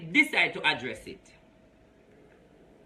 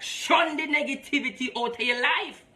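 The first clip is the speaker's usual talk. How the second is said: ordinary talk, nothing marked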